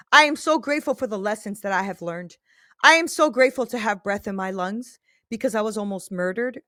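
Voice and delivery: normal talking voice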